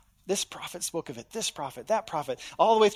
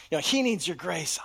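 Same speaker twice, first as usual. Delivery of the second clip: ordinary talk, nothing marked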